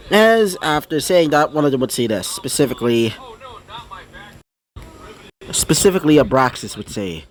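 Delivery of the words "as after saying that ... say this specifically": plain reading voice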